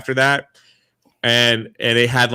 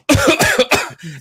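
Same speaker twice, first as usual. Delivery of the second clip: loud fake cough; 12 semitones higher, 4 dB louder than usual. Cough heard at Disney.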